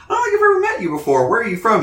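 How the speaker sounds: high, fast squeaky teacher; mock teacher voice. Retelling the teacher questioning Eleven, high mock voice. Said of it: "he's like".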